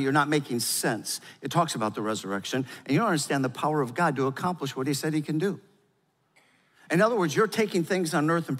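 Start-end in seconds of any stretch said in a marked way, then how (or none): none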